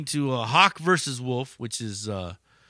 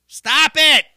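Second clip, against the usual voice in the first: exasperated yell; 11 semitones higher, 11 dB louder than usual. Real, not acted. Ranting at mumble rappers, loud exasperated shout. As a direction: Stop it shouted higher and louder. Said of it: "repeated stop it".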